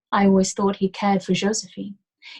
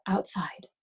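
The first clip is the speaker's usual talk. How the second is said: soft whisper, falling; softly; 5 dB quieter than usual. Acted. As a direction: whisper it gently, an invitation. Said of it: "I told him softly".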